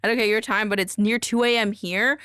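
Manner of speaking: ordinary talk, nothing marked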